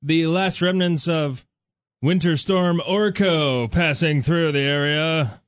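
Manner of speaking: slow, projected radio weather patter; radio DJ read. Real, not acted. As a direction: roll out the time and weather like a DJ. Reads station time and weather.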